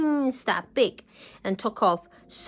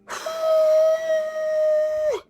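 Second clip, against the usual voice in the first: sharp high gasp. A narrator's reading. Dragon breathes out fire.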